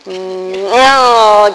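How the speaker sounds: sleepy drowsy murmurs; half-asleep droning; nodding off. Retold or imitated. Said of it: "kind of nodding off".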